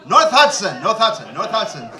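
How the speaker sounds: 'North Hudson' said loud and laughing, run together so it sounds like 'No Who'; local pronunciation. Shown as a demonstration. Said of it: "¿Qué quiere decir No Who?".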